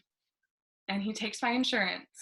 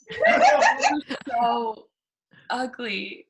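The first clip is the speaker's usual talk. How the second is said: higher and louder, laughing through it; laughing insult; 4 semitones higher, 7 dB louder, much slower than usual. Retold or imitated. Laughing at the ex she calls ugly. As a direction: crack up while calling him ugly.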